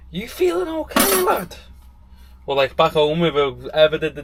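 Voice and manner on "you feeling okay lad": high, mock-surprised old man calling out; imitating grandad. Grandad calling to grandson at the sink, high mock disbelief. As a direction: play grandad, shocked his grandson is washing up. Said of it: "he'd be shouting at the telly".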